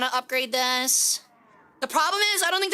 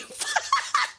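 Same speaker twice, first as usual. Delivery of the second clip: high breathy laugh. Real, not acted. Laughs after teasing friend.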